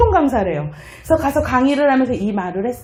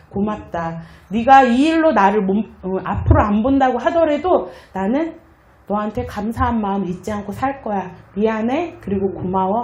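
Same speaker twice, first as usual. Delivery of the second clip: tender apology; 5 semitones lower than usual. Retold or imitated. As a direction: gently thank and apologize. Apology voicemail to friend.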